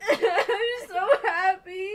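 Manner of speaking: loud, slow tearful wail; real happy sobbing, words drawn out; crying from happiness. Real, not acted. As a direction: sob it out through laughter. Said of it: "I'm actually crying".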